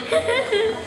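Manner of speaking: high, light laugh. Acted. Patient who prefers laughing to crying, laughing. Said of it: "עדיף לצחוק".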